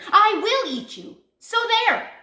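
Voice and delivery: high, very loud outburst; roared threat. A narrator's reading. Big Bad Wolf threatening number five, furious roar. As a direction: roar it, ending in a huff. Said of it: "he roared".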